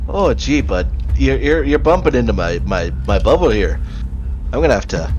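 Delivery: mildly put-out Minnesotan; folksy Minnesotan accent, stumbling politely. Acted. Dirge to a thrall crowding his bubble, Minnesotan accent. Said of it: "the Minnesotan accent is so hard to do".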